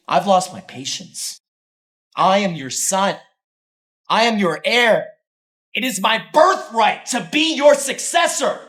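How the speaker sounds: slow, loud, rising defiance; defiant shout. Acted. Son demanding his birthright from father, defiant shouting.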